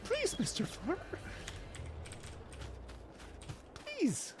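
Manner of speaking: high pleading little bird voice; gentle begging bird voice. Shown as a demonstration. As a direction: beg softly like a tiny bird. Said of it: "the gentle begging bird voice".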